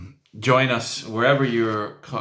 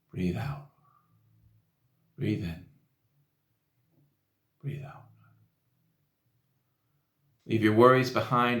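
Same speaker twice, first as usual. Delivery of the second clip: soft, unhurried meditation voice with long pauses; guided meditation; 4 dB quieter than usual. Real, not acted. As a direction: gently guide them through each breath. Leads a breathing meditation.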